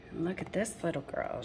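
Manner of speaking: plain reading voice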